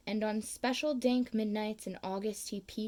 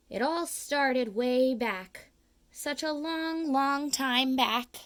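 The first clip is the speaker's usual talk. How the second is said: slow, drawn-out once-upon-a-time telling; slow, louder fireside storytelling; slow storyteller; 2 semitones higher, 4 dB louder, slower than usual. A narrator's reading. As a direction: stretch it out like the start of a tale. Storyteller slows for the tale.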